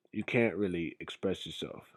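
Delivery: ordinary delivery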